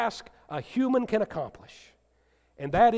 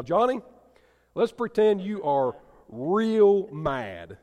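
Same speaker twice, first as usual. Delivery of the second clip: normal talking voice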